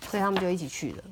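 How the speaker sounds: ordinary delivery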